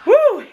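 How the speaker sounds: pained high woo from the heat; high loud woo. Real, not acted. Reaction to reaper chip burn.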